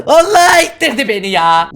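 high loud cry of alarm; screaming while falling. Real, not acted. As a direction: yell as you fall. Pushed off the edge.

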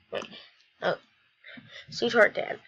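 ordinary delivery

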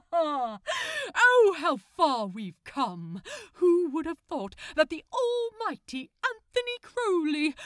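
loud, high theatrical gloat; gloating villain. Acted. Aziraphale gloating over captured Crowley, hammy villain. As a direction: ham up the triumphant villain.

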